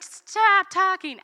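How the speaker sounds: high, loud, slow exasperated order; fed-up mom. Retold or imitated. Mom to chattering girls, slow high exasperated command. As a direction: draw it out like a mother at the end of her rope.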